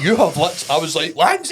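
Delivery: high, loud outraged call; reacting to a client's photo, mock outrage; exasperated cry. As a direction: cry out his name in disbelief. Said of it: "I'm like".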